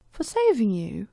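put-on a light touch of a naive child; naive childlike voice. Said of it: "His voice was like that of a child's in its naivety".

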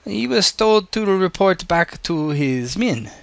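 put-on measured German-accented woman; Ingrid Becker. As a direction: answer slowly in a German accent. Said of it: "she says in a German accent".

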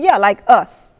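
"us" said with pointed weight; stress on US. Retold or imitated. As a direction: punch the word "us". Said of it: "I'm emphasizing us".

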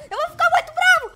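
rising mock outrage; mock angry threat. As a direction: threaten a tantrum, half in jest.

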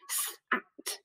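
S, A, T run together quickly, breathy and quiet. Shown as a demonstration. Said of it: "Bit faster".

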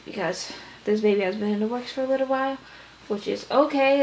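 usual speaking voice

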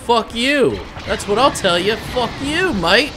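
loud, high Australian outburst; Australian accent. Pretend. Player cursing the enemy ship, mock Australian accent. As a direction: curse them out in a put-on Australian accent. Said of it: "turn Australian for some reason".

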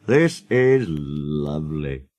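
low, slow musing; deep, contented murmur; contented thought. A narrator's reading. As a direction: savour it slowly to yourself. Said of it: "he thought".

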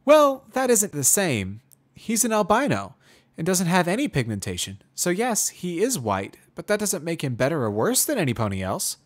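high, loud mare explaining kindly; Twilight explaining. Acted. Twilight explains albino prisoner.

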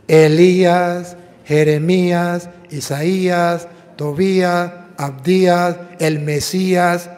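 Elías, Jeremías, Isaías drawn out slowly with the ending stressed. Shown as a demonstration. Preacher to congregation, stressing each name's -ías ending. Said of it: "todo termina Ía".

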